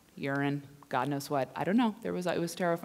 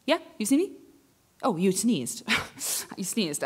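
normal talking voice

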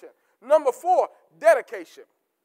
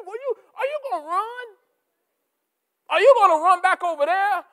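high, probing challenge; a higher voice daring them to fight; challenging voice of God; 5 semitones higher, 3 dB louder than usual. Retold or imitated. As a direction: lift up high and test them. Voicing God's challenge.

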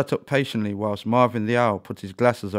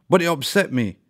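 loud, higher, hurt protest; more angry; 6 semitones higher, 6 dB louder than usual. A narrator's reading. Ella, still stung, protesting to the owl in anger. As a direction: push back, angrier now. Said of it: "Ella replied in a more angry tone".

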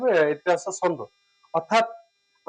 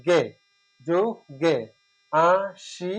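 জু গে আ সি split into single syllables with pauses; slower than usual. Shown as a demonstration. Teacher splitting a poem's words for students, syllable by syllable. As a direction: tap out each syllable of যুগে and আসি separately. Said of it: "দুটি মুক্ত অক্ষর".

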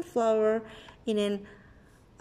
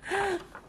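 frightened gasp; 8 semitones higher, 5 dB quieter than usual. Acted. Fear at the giant.

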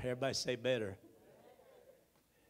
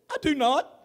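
loud, high, defensive retort; indignant denial; 11 semitones higher, 14 dB louder than usual. Retold or imitated. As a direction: snap back that it isn't true. Retold denial to wife in car.

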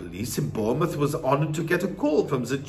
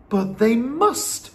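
high, drawn-out protest; insistent, raised boy voice; crying out; 9 semitones higher, 4 dB louder, slower than usual. A narrator's reading. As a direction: cry out in boyish disbelief. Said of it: "I cried".

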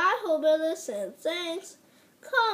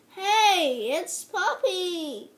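ordinary talk

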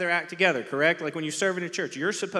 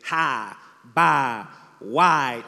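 Southern drawl with mouth pulled back, louder; southern drawl demo; 3 semitones lower, 7 dB louder, much slower than usual. Shown as a demonstration. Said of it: "pull your mouth back and say".

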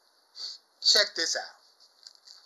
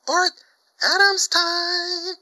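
much higher, louder, slow drawn-out call; hyped catchphrase; 13 semitones higher, 5 dB louder, much slower than usual. Real, not acted. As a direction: stretch Adams time like an announcer.

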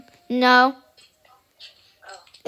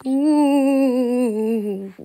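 long, unscary ghostly wail; ghost ooh. Pretend. Ghost Bunny trying to scare, long ghost wail. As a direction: hold a smooth ooh, sliding gently down, like a toy ghost. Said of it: "you don't sound scary at all".

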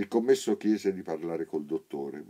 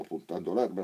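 ordinary delivery

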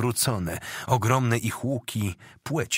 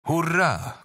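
high, moderately raised battle cheer; shouted hurrah; 8 semitones higher than usual. A narrator's reading. Polish soldiers cheering as they charge, restrained shouted hurrah. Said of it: "krzyknęła wiara".

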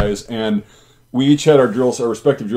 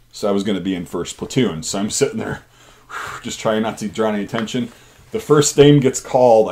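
ordinary talk

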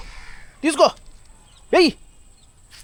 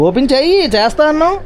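pleading; 9 semitones higher than usual. Acted. Coaxing toddler to open wrapper.

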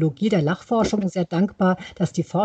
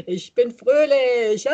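slow, higher, giggling; laughing yoga chant; 5 semitones higher, slower than usual. Shown as a demonstration. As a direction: sing it out with a giggle.

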